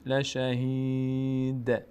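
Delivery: slow, drawn-out chanted Arabic recitation; slow Quran recitation. Shown as a demonstration. Teacher slowly reciting the verse for the student, chanted. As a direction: stretch every syllable as in tajweed. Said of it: "teacher recites verse ending".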